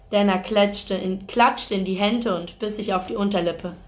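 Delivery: ordinary delivery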